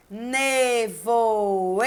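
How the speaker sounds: nevoeiro drawn out slowly, ne-vo-ei-ro; syllable by syllable. Shown as a demonstration. Teacher giving the second example word, nevoeiro slowly syllable by syllable. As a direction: hold every syllable of nevoeiro. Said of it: "Quatro sílabas".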